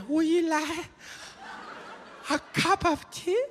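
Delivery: very high, hammed-up Chinese accent; mock Chinese accent. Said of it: "just happens to say".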